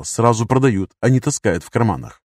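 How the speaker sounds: ordinary delivery, nothing marked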